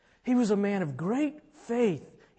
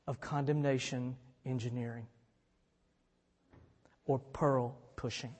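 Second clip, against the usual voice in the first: softer, lower recap of condemnation engineering and pearl pushing; hushed pastoral recap; quiet reflection; 6 semitones lower, 9 dB quieter than usual. Real, not acted. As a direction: say it gently to the hurt listener. Preacher quietly recalls the phrases.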